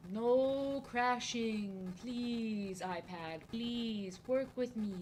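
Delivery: very slow, stretched pleading; long begging moan; drawn-out plea. Pretend. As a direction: stretch every sound, begging the iPad.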